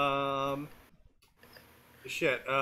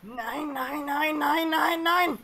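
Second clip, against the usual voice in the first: German nein; 5 semitones higher, 4 dB quieter, slower than usual. Pretend. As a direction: chant "Nine" as nein, each one higher. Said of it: "the most important German word".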